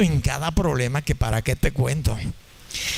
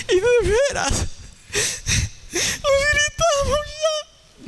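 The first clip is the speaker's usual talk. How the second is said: much higher, wailing mock sob through laughter; hammed-up crying while cracking up; laughing fake cry; slower than usual. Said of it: "No aguantaba la risa". (llanto fingido, muy agudo, entre risas)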